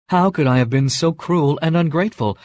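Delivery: Beauty waking in dismay, crying out; anguished outcry of self-reproach. Acted. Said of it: "she cried".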